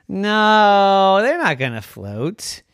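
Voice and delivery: put-on a sharp, loud kid's no. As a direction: shoot the idea down. Kid rejecting idea.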